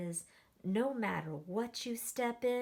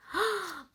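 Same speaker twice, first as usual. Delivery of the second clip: sharp gasp; 7 semitones higher, 5 dB louder than usual. Acted. Reacting to mud.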